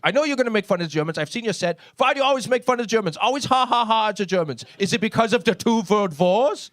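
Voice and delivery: put-on high, rushed German-accented complaint; mad German friend. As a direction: rant indignantly in a German accent. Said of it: "he gets mad 'cause I make fun of the Germans".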